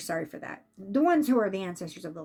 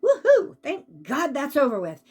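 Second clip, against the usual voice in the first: high, loud whoop tossed off before a relieved line; joyful whoop; 4 semitones higher, 5 dB louder than usual. Retold or imitated. Spirit whooping after his miserable illness ended. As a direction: whoop it out like a weight just dropped. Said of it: "his initial feeling when he passed away was".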